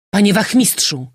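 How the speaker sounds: loud, high hail; shouting. A narrator's reading. General hailing the sergeant at the gate, shouting. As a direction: call out urgently to an officer. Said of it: "wykrzyknął".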